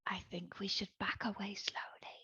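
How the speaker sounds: very soft, voiceless whisper. Pretend. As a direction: whisper it as if backing away from danger. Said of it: "Yeah, and whisper".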